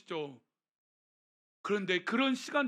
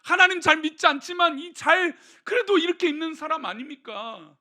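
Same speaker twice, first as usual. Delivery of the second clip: louder, higher, pleading for the nephew; Abraham's pleading heart; 6 semitones higher, 5 dB louder than usual. Retold or imitated. Abraham pleading with God for his nephew Lot, earnest. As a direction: plead with God like a worried uncle. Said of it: "아브라함의 속마음은 뭘까요?".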